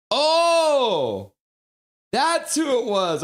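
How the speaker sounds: high, loud sudden oh; surprised realization. Real, not acted. Streamer realizing who sent the message, surprised. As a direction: let the penny drop out loud.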